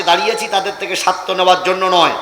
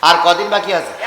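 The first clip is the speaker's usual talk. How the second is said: ordinary delivery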